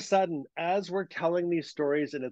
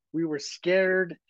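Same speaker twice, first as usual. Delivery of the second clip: ordinary talk, nothing marked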